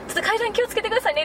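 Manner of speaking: high, quick warning; fast caution. Retold or imitated. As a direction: rattle off a caution. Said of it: "って言って".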